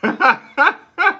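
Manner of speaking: high loud laugh breaking in; bursting laugh. Real, not acted. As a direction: crack up mid-sentence. Laughing at own football story.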